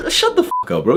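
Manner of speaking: shrieking shut up in disbelief. Real, not acted.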